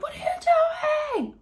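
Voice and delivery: high, slow shout at her; startled shout at a stranger banging on the car. Retold or imitated. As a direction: yell it out the window. Shouting at the woman.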